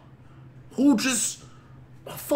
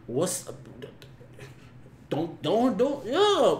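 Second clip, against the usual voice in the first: normal talking voice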